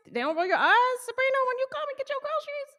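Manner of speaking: high, sassy mock eye-roll; mock sass. Clerk greeting Sabrina at the groceries, mock sassy. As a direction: put on a high-pitched snippy clerk.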